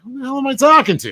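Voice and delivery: high, fast, incredulous outburst; squeaky rush of disbelief; mock exasperation. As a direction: blurt it in comic self-conscious bewilderment. Acting out self-conscious solo recording.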